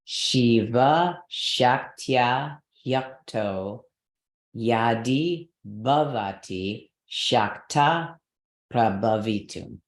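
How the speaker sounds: slow Sanskrit chant with each stress leaned on; slow rhythmic recitation. Shown as a demonstration. Teacher demonstrating the verse rhythm, slow and stressed. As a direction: model the stressed beats slowly for the class. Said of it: "So I would say".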